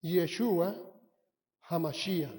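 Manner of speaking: Yeshua HaMashiach said carefully as ye-SHU-a ha-ma-SHI-ach; Hebrew name said clearly. Shown as a demonstration. Preacher teaching the congregation the Hebrew name, carefully pronounced. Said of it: "La palabra hebrea para Jesucristo es".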